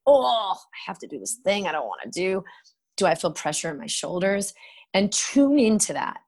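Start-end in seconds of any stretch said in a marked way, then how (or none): 0.1-2.4 grudging, put-upon inner voice; reluctant whine. Retold or imitated. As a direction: sigh it out like a chore.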